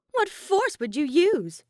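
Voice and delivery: mocking, high and loud, drawn out slowly; taunting disbelief; scoffing. A narrator's reading. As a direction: sneer at the threat. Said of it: "Kokichi scoffed".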